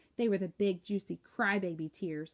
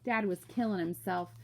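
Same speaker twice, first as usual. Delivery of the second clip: ordinary delivery, nothing marked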